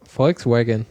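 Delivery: "Volkswagen" said English-style, with a W as in wagon; mock mispronunciation. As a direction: imitate a wrong-pronunciation video saying "Volkswagen". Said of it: "wie spricht man Volkswagen aus".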